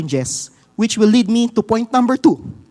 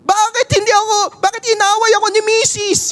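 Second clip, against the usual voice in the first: much higher, fast whining question; hammed-up griping believer; mock complainer; 12 semitones higher than usual. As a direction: believer whining to God after rejection, high and fast. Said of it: "napapatanong ka".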